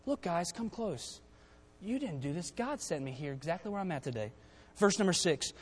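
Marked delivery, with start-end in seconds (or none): 0.1-4.3 tender forgiveness. Retold or imitated. Joseph speaking to the brothers who sold him, softly forgiving. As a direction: gently draw the brothers close. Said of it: "look your brothers in the face and say".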